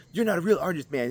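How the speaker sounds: high, sneering put-on critic voice; mocking critic. Vlogger mocking a critic of his AI art, high sneer. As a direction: scoff it like a snobby online art critic. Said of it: "If you're like".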